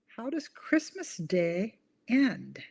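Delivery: very slow, stretched question; drawn-out riddle. Shown as a demonstration. Host repeating the riddle for viewers, slowly. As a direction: draw each word out to tease.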